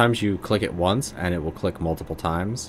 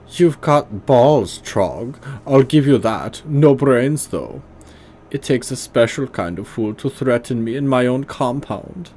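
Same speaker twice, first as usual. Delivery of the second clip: hammed-up bad German accent, higher and louder; awful German accent; 6 semitones higher, 5 dB louder than usual. Leader Stahl interrogates the troll in an awful German accent. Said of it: "I'm just giving him a awful German accent".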